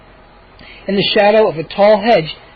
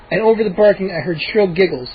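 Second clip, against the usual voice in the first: usual speaking voice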